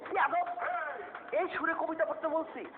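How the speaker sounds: loud, high scolding; angry outburst. Acted. কবি ছাত্রের আবৃত্তিতে রেগে ধমক দেয়. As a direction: scold him in outrage.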